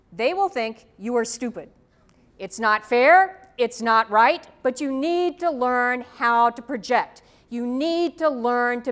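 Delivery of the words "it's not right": ordinary talk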